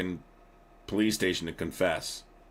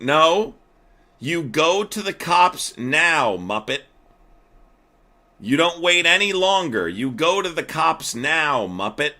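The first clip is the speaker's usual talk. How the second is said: yelling; 6 semitones higher, 5 dB louder than usual. Real, not acted. Streamer yelling orders at chatter.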